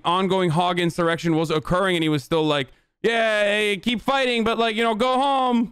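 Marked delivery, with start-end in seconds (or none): 3.0-5.7 Trump quote in a hammed-up Italian accent; lightly put-on Italian lilt, higher; mock Italian accent. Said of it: "Why am I doing an Italian accent there?".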